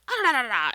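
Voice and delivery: loud high snarly dog noises; imitating dogs fighting. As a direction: mimic dogs yelling at each other.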